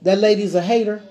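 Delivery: ordinary talk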